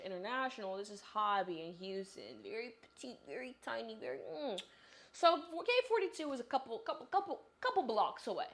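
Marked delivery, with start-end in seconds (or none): 5.1-8.5 excited exaggeration. Real, not acted. Exaggerating walking distance.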